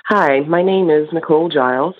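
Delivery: ordinary talk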